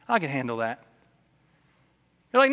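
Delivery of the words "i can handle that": calm God. Retold or imitated. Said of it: "And God's like".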